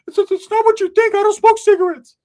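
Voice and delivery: very high, loud squeaky friend voice; Ricky's squeaky voice. Retold or imitated. Ricky explaining himself to the chick, very high and loud.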